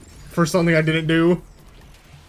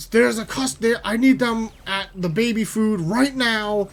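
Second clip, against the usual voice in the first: raised, higher-pitched, aggressive intercom call; mock an irate coworker demanding help over the intercom; pushy retold yelling, pitched up; imitating aggressive cashier; 6 semitones higher than usual. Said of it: "aggressively just being like".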